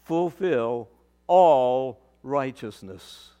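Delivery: slow, low, solemn words of Jesus. Acted. A pastor voicing Jesus to his congregation, slow and solemn. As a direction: lay each word down slowly, gravely. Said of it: "And Jesus said".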